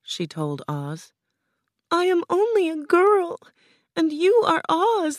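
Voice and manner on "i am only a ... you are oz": put-on very high, plaintive girl voice; tearful. A narrator's reading. Dorothy begins to cry and refuses, tearful. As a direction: wail it small and high, near tears. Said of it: "Dorothy began to cry".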